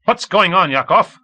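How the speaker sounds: high, loud barked question; sharp bark. A narrator's reading. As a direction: snap the question out. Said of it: "Komov barked".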